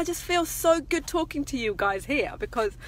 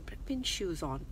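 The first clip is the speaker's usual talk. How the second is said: silent thought; 4 semitones lower, 11 dB quieter than usual. Retold or imitated. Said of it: "just thought".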